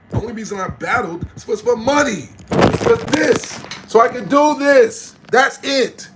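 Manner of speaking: boastful yell at the chat; excited shout. Real, not acted. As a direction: shout it out proudly. Shouting at chat trolls on live.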